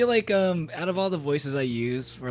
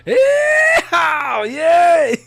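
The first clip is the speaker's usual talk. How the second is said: much higher, shouted yeehaw; cowboy yeehaw; 13 dB louder, much slower than usual. Pretend. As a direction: whoop it out like a rodeo cowboy. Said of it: "which is just my cowboy voice".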